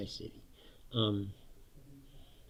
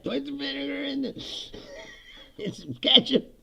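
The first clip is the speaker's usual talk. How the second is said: mock Southern cook; 13 semitones higher than usual. Voicing Southerners improvising sauce.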